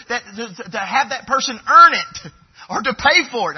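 high, loud fervent preaching; a preacher declaring nothing can be earned, full force. Real, not acted. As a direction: pour it out to the congregation.

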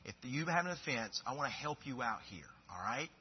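put-on soft, patient coaxing of a child; a dad quietly coaxing his children to be honest; gentle fatherly plea. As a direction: talk softly to your kid. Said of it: "I'm saying, look, to my kids".

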